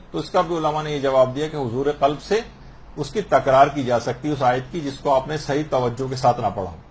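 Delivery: ordinary talk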